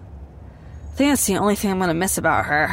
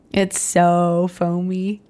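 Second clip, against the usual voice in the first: put-on drunken savouring; 2 semitones lower than usual. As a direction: draw out foamy like a tipsy friend. Drunk Beth savouring the soap.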